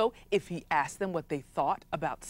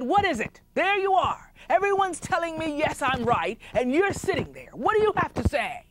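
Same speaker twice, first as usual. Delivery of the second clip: irritable chief ranting; 9 semitones higher than usual. Acted. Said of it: "And he said".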